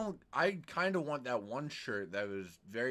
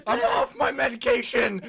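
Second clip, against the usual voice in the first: laughing; 10 semitones higher, 6 dB louder than usual. Real, not acted. Laughing manic joke.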